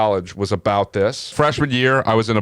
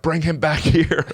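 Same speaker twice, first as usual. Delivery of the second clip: mock scream; 6 semitones higher than usual. Woman calling the cab driver back, mild mock scream. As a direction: imitate her screaming without full volume.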